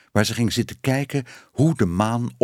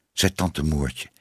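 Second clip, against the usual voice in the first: plain reading voice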